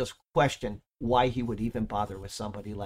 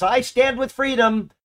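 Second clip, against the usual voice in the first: a higher, louder proud declaration; rallying cry; 12 semitones higher, 8 dB louder than usual. Acted. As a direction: cry it out as if leaping to your feet. Rennie jumps up, answers call.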